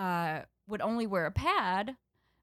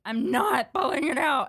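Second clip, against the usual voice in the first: higher and louder, defiant; stubborn yell; 6 semitones higher, 6 dB louder than usual. Retold or imitated. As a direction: dig in like a stubborn kid. Stubborn kid refusing loudly.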